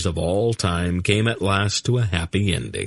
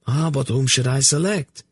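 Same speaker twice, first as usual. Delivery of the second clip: high, helpless question; a flustered nobleman at a loss; high, mildly helpless plea; 8 semitones higher than usual. Acted. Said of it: "Laldoran asked helplessly".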